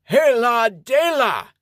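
words mashed by a grip on his face, high and slow; squished cheeks. Acted. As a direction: speak through squeezed cheeks. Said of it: "He tries to speak".

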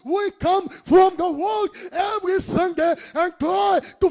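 high, loud, driving sermon; full-force preaching. Real, not acted. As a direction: preach it out, building to a shout.

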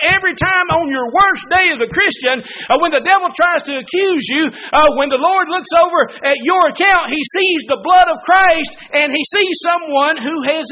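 loud, high-pitched revival preaching; fiery preaching. Acted. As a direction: preach it out to the whole church. Preaching at full force.

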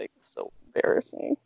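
breathless mortified whisper. Real, not acted. Admitting her embarrassment to the host, hushed whisper. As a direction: hush it out, cringing. Mortified aside after story.

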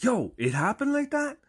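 high-pitched incredulous question; mock astonishment. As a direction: come out of your shell, amazed. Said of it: "comes out of his kinda conserved shell".

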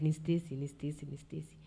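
a daughter begging to be recognized, fading to nothing; pleading, fading away. Retold or imitated. Pleading her name to sick mother.

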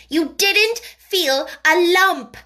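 put-on high, loud fierce child; fierce contradiction. A narrator's reading. Mary denies the boy felt a lump, fiercely. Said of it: "contradicted Mary fiercely".

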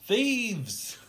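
high, loud cry of alarm; yell. Acted. Robbed Little Faith yells at the thieves, high loud yell. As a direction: yell it out like a robbed man calling out. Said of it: "And he yells".

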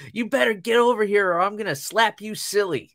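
high-pitched mock bossy kid; retold movie line, loud and high; imitating little girl's threat. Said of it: "and she's like".

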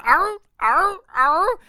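very high-pitched barking seal impression; screeching seal. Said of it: "making a screeching sound".

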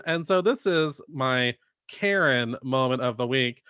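usual speaking voice

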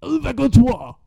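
showing off French, jumping high at the end; put-on French, hyped-up; mock fluent French. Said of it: "I was speaking fucking fluent French".

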